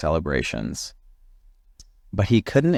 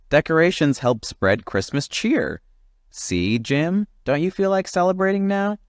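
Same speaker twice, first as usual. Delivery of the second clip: high, bright chirpy bird voice; high cheerful bird coaxing a grumpy friend; cheery bird; 11 semitones higher than usual. Acted. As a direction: chirp it out like a festive little bird. Oxpecker voiced in story.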